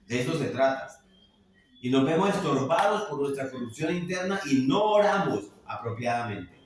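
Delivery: loud, impassioned pulpit delivery, building in force; fervent preaching. Acted. Pastor warns his congregation about prayer, loud fervent preaching. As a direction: drive the warning home to the congregation.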